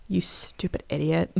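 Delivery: very soft, low jab; hushed, low scolding aside; muttered scolding. Acted. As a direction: mutter the insult under your breath. Scolding the arrested girl as aside.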